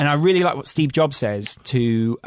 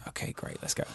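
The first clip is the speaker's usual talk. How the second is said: whisper; 16 dB quieter than usual. Retold or imitated. Bracing himself at 5 AM.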